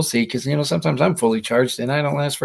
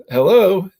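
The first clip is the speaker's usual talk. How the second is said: sarcastic hello; 8 semitones higher, 8 dB louder than usual. Real, not acted. Sarcastic aside about the border.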